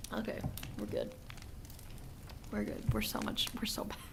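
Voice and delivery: hushed whisper to self. Real, not acted. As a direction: breathe it out under your breath.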